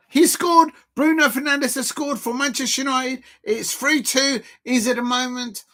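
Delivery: celebrating the penalty goal; goal celebration. Real, not acted. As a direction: shout the goal news out.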